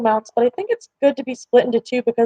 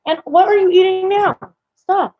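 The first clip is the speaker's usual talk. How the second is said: scolding; 8 semitones higher than usual. Real, not acted. Scolding the cat again.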